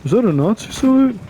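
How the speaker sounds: high, loud put-on commenter asking; mocking a commenter's question. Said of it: "people in the comment section that are like".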